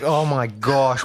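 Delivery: ordinary talk, nothing marked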